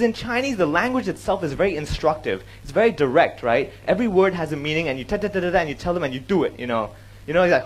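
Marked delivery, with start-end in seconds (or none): none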